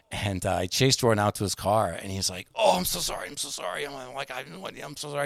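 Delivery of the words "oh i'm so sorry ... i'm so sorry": high, softening, panicky apologizing; frantic apology. Retold or imitated. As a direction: imitate someone begging forgiveness. Manager chasing Jordan to apologize.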